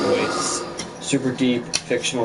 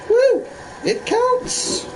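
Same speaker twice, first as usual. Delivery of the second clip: excited; much slower than usual. Real, not acted. Celebrating still getting full completion.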